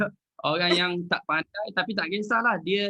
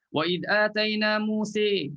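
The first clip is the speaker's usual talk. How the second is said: slow chant, stopping at Musa; tajwid recitation; 5 semitones higher, slower than usual. Shown as a demonstration. Ustaz showing a pause then a repeat, chanted. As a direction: recite slowly and halt.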